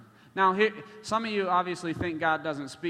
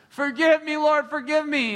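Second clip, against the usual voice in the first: high, louder pleading cry; a woman crying out to God, high and loud; tearful plea; 11 semitones higher, 7 dB louder than usual. Retold or imitated. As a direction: beg forgiveness through tears. Susie weeping, begging forgiveness.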